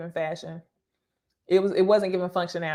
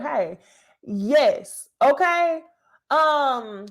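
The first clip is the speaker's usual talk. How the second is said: ordinary talk, nothing marked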